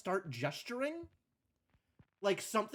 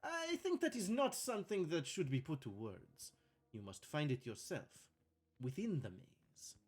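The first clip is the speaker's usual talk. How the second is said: mysterious host in fake Italian accent; bad Italian accent; 4 dB quieter than usual. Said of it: "faking a bad Italian accent".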